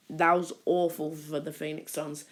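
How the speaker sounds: ordinary delivery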